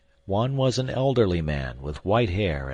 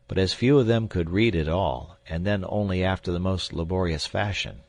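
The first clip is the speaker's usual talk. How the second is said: plain reading voice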